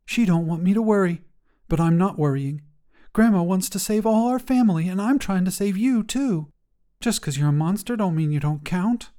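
put-on a young girl begging her closet monster to hide; worried kid voice, pushing hard to be believed; earnest little girl. Jacayla talks to closet monster.

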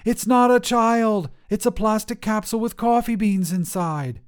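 loud, high furious yelling; angry outburst. Acted. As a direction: shout it at the hotline in disbelief. Lenore yells at baby-making hotline.

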